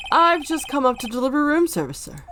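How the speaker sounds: high, eager hotel bellboy; bow and greet like a proper bellhop; chirpy old-fashioned bellhop. Acted. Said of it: "I am the bellboy".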